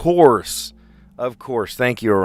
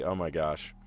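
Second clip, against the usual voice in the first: ordinary delivery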